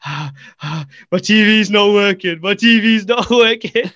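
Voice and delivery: mock out-of-breath wail; breathless panic. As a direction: act out arriving winded from a sprint. Breathless child after sprinting to reception.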